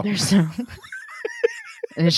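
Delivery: laughing. Real, not acted. Joke with laugh heard.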